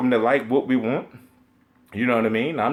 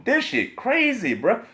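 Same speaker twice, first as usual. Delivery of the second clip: disbelief; 10 semitones higher than usual. Real, not acted. Disbelief at console trash talk.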